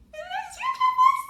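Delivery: very high, quick squeak; squeaky teasing repeat. Pretend. Reviewer teasing the driver reveal, squeaky and playful. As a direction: repeat it in a tiny high-pitched voice.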